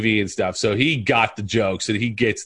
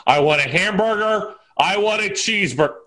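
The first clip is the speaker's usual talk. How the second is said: high-pitched movie-quote demand; mock demanding order; 7 semitones higher than usual.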